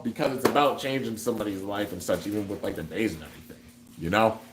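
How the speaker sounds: ordinary talk, nothing marked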